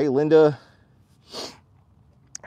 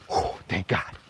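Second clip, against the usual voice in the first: relieved whisper; 3 semitones lower, 7 dB quieter than usual. Real, not acted. Relief after the dogs turn back.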